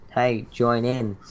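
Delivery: normal talking voice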